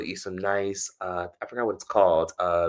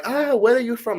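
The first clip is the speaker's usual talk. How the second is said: put-on high, accented call to a stranger; imitated street man; 4 dB louder than usual. Man on the street asking a passerby, imitated accent. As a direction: imitate a foreign man calling out, pitched way up.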